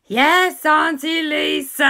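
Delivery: high, loud chorus; two boys shouting yes in unison; boys' voices. Acted. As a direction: answer together like eager kids. Boys answer.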